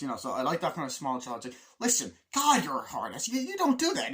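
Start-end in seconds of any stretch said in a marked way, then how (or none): 1.8-4.1 high, loud mock squabble; acted-out exasperated outburst; bickering. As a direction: snipe like an exasperated travel companion, hammed up. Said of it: "the bickering that the two of them have".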